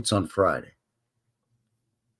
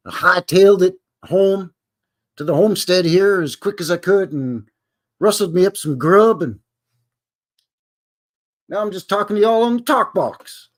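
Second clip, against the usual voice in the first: cowboy voice; 7 semitones higher, 3 dB louder than usual. Pretend. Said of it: "I'm trying to sound like a cowboy".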